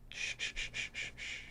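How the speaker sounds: breathy unvoiced shh, very soft; a creepy soothing hush; whispered shh from an uncanny figure; eerie whispered shushing. A narrator's reading. Eerie vampire hushes again.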